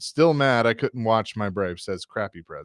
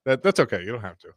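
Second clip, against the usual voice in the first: ordinary talk, nothing marked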